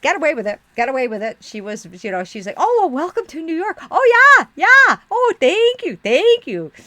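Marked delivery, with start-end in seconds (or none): 2.6-6.7 loud, high, hammed-up Minnesota gushing; shrieky mock Minnesota politeness; Minnesota accent. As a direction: overdo the delighted Minnesota twang. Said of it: "I did use that, um, accent".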